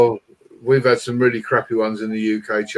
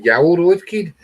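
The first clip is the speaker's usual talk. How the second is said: lightly put-on Brummie directions; playful mock Midlands accent; 2 semitones higher than usual. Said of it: "They talk like this".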